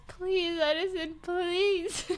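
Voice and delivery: high, whiny mock begging; pleading. Retold begging of wobbly partner to walk straight, pleading. Begging unsteady Edison to cooperate.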